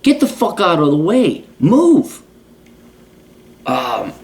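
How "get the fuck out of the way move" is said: high exasperated barking at blockers; mock yell. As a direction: shout them out of the aisle, higher. Yelling at shoppers blocking aisles.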